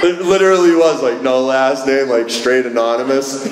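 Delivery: laughing through the words, amused; can't stop laughing at the card. Real, not acted.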